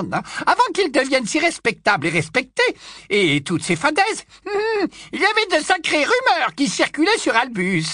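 put-on high, loud old busybody voice; high loud tipsy old aunt gushing; old aunt Muriel. Muriel taunting after a burp.

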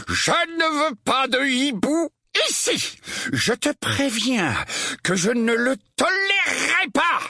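slow, high-pitched angry warning; furious roar. A narrator's reading. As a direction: lay down the law in a drawn-out rage. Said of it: "rugit l'oncle Vernon". (avertissement furieux, voix aiguë)

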